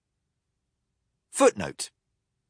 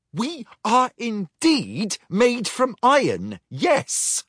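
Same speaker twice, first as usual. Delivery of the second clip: higher prim robot voice; 10 semitones higher, slower than usual. Acted. Quartermaster answers the captain primly, higher voice. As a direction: say it primly and precisely. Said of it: "said Lexi primly".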